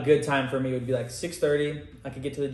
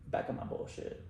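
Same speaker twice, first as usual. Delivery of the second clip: breathy whisper under her breath; whispered aside; 11 dB quieter than usual. Real, not acted. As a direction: drop to a whisper. Trailing aside about slacking.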